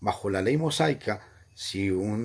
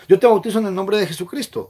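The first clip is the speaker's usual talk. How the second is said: higher, louder, fast recitation; baptismal formula voice; 7 semitones higher, 8 dB louder, faster than usual. Retold or imitated. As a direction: rattle off the officiant's words. (fórmula bautismal, rápida y más fuerte)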